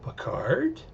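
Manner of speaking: a high, breathless whispered Picard?; startled whisper. Pretend. Maddox waking from the drug, whispering in surprise. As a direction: gasp the name in disbelief.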